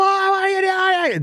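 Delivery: high, slow, stretched-out greeting; imitating a buddy's delighted hello; excited friend greeting. Buddy spotting him at the airport, excited high greeting.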